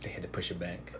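soft unvoiced whisper. Real, not acted. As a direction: breathe it as a quiet aside. Quiet aside in group chat.

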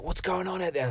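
high, soft and anxious; worried survivors. Retold or imitated. Survivors ask what is happening outside, a touch of worry. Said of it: "they're like".

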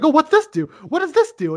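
put-on high, eager curious kid voice; mock-innocent high-pitched questions; childlike wonder. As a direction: poke at everything like an overexcited child. Buddy pestering dad at work.

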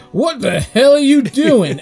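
drawn-out, high shocked yell of disbelief; shocked townsfolk. Retold or imitated. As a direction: play the stunned saloon crowd, slowly and high. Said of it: "And they're like".

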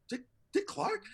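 high, squeaky double-take; stunned disbelief. Retold or imitated. Warm-up comic hearing whose entrance he must announce, stunned. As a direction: echo the name in incredulous surprise.

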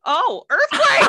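high, loud mock panic about an earthquake; jokey earthquake scare; mock alarm. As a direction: cry out in play alarm. Joking alarm at broken chair.

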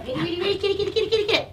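high, rapid-fire urging; high, eager chant of prompts; working the dog into a frenzy. Real, not acted. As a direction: rev the dog up with repeats. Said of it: "I'm gonna make her crazy".

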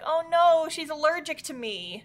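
high, hammed-up dismay; imitating a heartbroken anime girl; mock despair. Acting distressed girlfriend.

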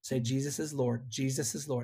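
soft, low coaxing murmur; retold ear whisper. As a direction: prompt someone quietly at their ear. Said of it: "in her ear and I said".

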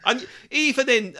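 ordinary delivery

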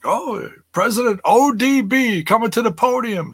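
high mock announcer intro; a playful, lightly voiced MC. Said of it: "it's like".

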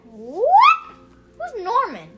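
sharp high loud what; surprised. Real, not acted. Puzzled reaction to Norbit mention.

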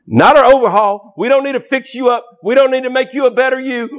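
fast, driving sermon delivery; fiery preaching. Acted. Preacher urging the congregation to die to self, fervent. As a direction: press the point hard and quick.

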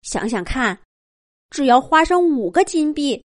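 father mouse talking himself into it; eager father mouse. Acted. Father mouse's eager quoted speech.

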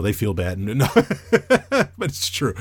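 ordinary talk, nothing marked

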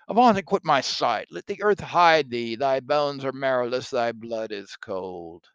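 loud, raging Macbeth at the ghost; hammy stage actor. Acted. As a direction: drive the ghost away.

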